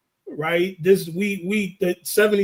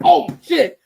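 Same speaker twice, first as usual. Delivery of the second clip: startled yelp; 9 semitones higher, 6 dB louder than usual. Retold or imitated. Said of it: "He was like".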